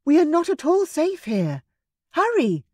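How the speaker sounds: high, urgent hurry; urgent warning. Acted. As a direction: push them to flee. Urging escape from hippo.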